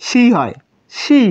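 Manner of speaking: slow drawn-out সি, said like shi; clear Japanese shi. Shown as a demonstration. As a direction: stretch সি slowly as shi. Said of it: "কিন্তু সি". (ধীরে টেনে সি উচ্চারণ)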